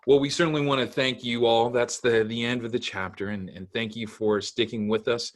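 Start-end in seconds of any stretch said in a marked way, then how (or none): none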